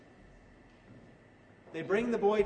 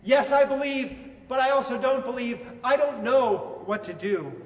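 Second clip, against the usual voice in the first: loud, higher outburst of doubt; torn, conflicted; 5 semitones higher, 6 dB louder than usual. Acted. A father torn between faith and doubt, crying out helplessly. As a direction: cry out as if tossed every which way. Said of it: "he's tossed every which way".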